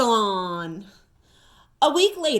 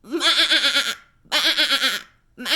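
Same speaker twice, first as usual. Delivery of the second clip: sheep bleat; much faster than usual. Acted. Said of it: "said Pete".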